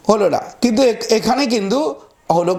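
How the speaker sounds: ordinary delivery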